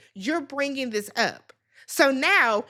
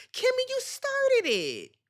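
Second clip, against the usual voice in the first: scolding; 11 semitones higher, slower than usual. Real, not acted. Scolding Kimmy.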